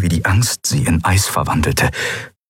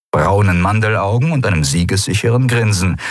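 ordinary delivery, nothing marked

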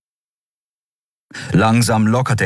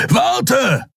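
high, urgent call; calling after someone. A narrator's reading. Kero chasing Kai down the stairs, calling out. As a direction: call it after a fleeing man. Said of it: "rief Kero dem Menschen hinterher".

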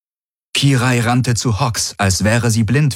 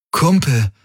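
pained, higher-pitched appeal to a friend; anguished; 6 semitones higher than usual. A narrator's reading. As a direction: plead with your friend in torment. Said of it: "sagte Garland gequält".

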